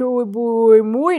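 lightly strangled "ui, bui, mui"; a hint of the rusty stove-maker; lightly mocking the stiff-jawed mumble; mock creaky mumble. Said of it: "Se aveste detto invece".

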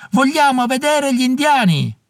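very high, strained mob voice; heckler voice. A narrator's reading. Voices from the back rows answering Garrick, high mob voice. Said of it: "Un paio di voci risposero".

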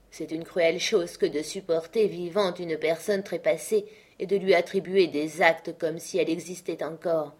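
usual speaking voice

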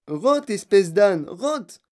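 slow, high mock shout, loud at first; retold yelling. A narrator's reading. Wonka retelling his shouts at the Oompa Loompa, yelling. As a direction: holler the order up at him, drawn out. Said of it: "J'étais là en train de lui crier".

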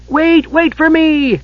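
high, drawn-out yell; high-pitched plea from someone left behind. A narrator's reading. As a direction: call after them in a high, stretched voice. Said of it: "Tass yelled".

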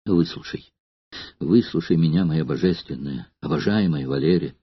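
low, soft and shaking; tender plea with a quavering voice; trembling voice. A narrator's reading. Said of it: "сказал дрожащим голосом Спартак". (дрожащим голосом)

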